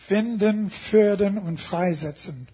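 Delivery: loud, thick German accent, each word rolled out; German words in a thick German accent, said loudly. Shown as a demonstration. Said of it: "in a good, thick German accent".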